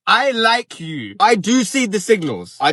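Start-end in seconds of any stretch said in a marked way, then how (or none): 0.1-1.1 slow mock confession. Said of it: "grab my face and be like".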